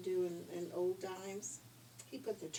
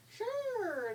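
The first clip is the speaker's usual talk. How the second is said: squeaky high little pig; bright high little pig; high piggy voice; 14 semitones higher than usual. Acted. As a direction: pitch the pig way up.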